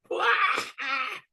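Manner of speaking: high, shouting howl of laughter; rolling laugh. Acted. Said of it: "He starts laughing and rolling".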